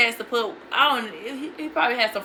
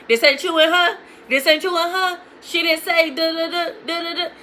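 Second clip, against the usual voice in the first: retold grilling, raised and insistent; accusatory questioning; 5 semitones higher, 4 dB louder than usual. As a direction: confront him with proof in a higher voice. Retold confrontation over the messages.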